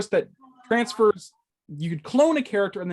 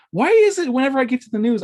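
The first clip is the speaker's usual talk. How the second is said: loud, higher, fast outburst of frustration; exasperated; 6 semitones higher, 9 dB louder, faster than usual. Real, not acted. Host annoyed at stumbling during the news, loud exasperated complaint. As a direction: burst out in real exasperation at yourself.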